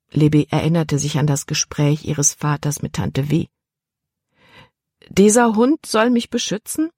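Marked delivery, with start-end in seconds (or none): 5.1-6.9 higher, disbelieving question; incredulous. A narrator's reading. Libby doubts the dog can protect her, incredulous. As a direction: ask as if it were ridiculous. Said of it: "Das erschien lächerlich".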